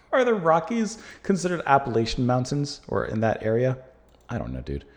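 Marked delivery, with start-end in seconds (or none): none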